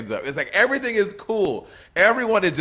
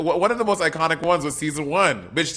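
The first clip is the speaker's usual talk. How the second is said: ordinary delivery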